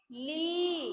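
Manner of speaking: "ली" with the ee held long; long ee vowel. Shown as a demonstration. Teacher modelling the matra for students, long-drawn "ली". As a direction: stretch out the vowel of "ली". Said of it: "लंबा होता है ना".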